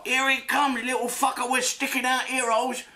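squeaky mock accent, scheming; mock Chinese restaurant worker. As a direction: plot revenge on the customer in a silly high voice. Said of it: "They're gonna get a memo from him".

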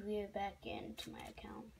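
whispered aside to self; whispering. Real, not acted. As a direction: breathe it out as a whisper. Quiet aside while typing.

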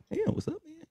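lightly mocked high, soft voice. Telling about a tall teen, a mock light high voice. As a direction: squeak it up a little, surprised it's so light. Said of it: "Voice all light and shit".

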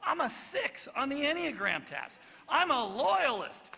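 mock indignant shout; mock Peter shouting. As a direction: overplay a proud loyalist. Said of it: "he might have said".

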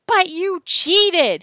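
very loud, high, drawn-out shout. A narrator's reading. Tom accuses Joe of cheating, drawn-out shout. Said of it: "shouted Tom".

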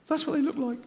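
high, insistent frightened voice; panicky inner voice. Retold or imitated. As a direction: push the paranoid point up high. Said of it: "this one goes".

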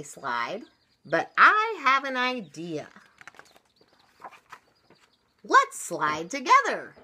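lively, loud storybook reading with big pitch swings; story read-aloud. A narrator's reading. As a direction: read it big and animated for small children. Reads a picture book to children.

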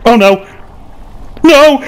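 usual speaking voice

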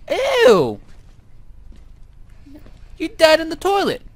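high, loud disgusted squeal. Real, not acted. Grossed out.

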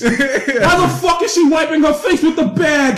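high, loud ranting comic, laughing through it; Bill Burr impression. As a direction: rant like everything upsets you. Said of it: "That's a good impression".

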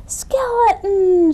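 high, loud, drawn-out call; shouting. A narrator's reading. Goblin spots his lost friend, high drawn-out shout. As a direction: shout the name slowly across a distance. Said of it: "shouted Goblin".

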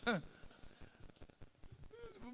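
high laugh. Real, not acted.

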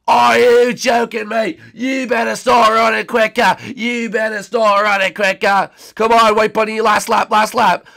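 loud, high urging; agitated race-fan shouting. Acted. Bettor urging the bunny on the last lap, shouting. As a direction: yell at your racer to hurry.

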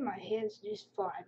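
ordinary talk, nothing marked

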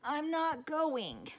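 high, slow pouting refusal; stubborn child. A narrator's reading. Sarah refusing her father, high and slow. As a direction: dig in your heels like a sulky kid.

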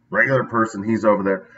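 ordinary talk, nothing marked

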